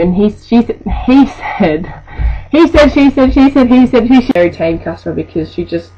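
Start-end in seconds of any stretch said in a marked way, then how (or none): 1.0-4.3 exasperated mocking gibberish; mock he-said-she-said babble. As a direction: parody the pointless back-and-forth. Mocking the back-and-forth.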